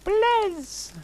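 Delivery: begging. A narrator's reading. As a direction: plead desperately in a high voice. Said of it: "I begged".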